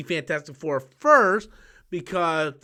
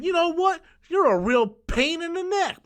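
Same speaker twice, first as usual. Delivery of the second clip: much higher mock woman's voice; high mock Lois; 11 semitones higher than usual. Lois scolding Superman, high mock voice. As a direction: squeak it out like Lois. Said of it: "he's like".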